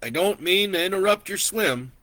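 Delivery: a lightly voiced old rancher, friendly; easygoing old neighbor at the river; old man's voice. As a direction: raise the pitch for a kindly old man.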